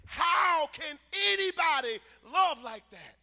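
loud, incredulous cry from the pulpit; big, booming rhetorical question; impassioned preaching shout. Real, not acted.

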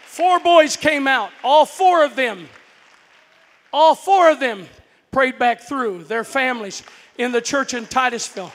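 full-force preaching; fiery preaching. Acted. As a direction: preach it home to the congregation.

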